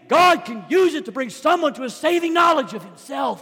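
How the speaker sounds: loud, high, driving sermon cadence; full-force preaching. Acted. Preacher to his church, rising fervent preaching. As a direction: preach it hard to the congregation.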